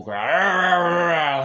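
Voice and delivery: long, loud, high mock animal growl; weird animal growl. Retelling Vince's anger at the spray paint, mock animal growl. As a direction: growl it out, imitating an enraged beast. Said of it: "making some weird".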